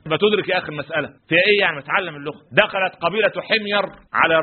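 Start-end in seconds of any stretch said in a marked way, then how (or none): none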